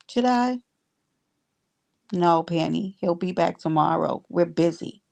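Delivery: ordinary talk, nothing marked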